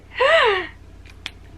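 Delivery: big, high gasp; shocked gasp. Real, not acted. Reader reacting to the secret, shocked gasp. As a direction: gasp sharply at a twist. Gasps at the plot twist.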